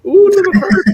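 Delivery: high delighted coo. Real, not acted. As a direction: squeal over something cute. Cooing at happy creature.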